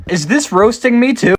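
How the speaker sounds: high, loud outraged question; incredulous at being roasted; indignant. Real, not acted. Indignant at filter.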